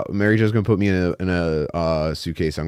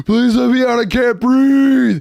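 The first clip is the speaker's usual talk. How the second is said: much higher, slow, gasping plea; claustrophobic panic; 12 semitones higher, 4 dB louder, slower than usual. Retold or imitated. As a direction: beg to be let out, can't breathe. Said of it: "This guy's just like".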